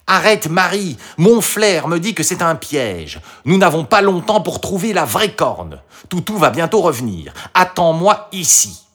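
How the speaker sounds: high-pitched, pressing fox voice; urgent warning. A narrator's reading. The fox warns Marie of a trap, urgent.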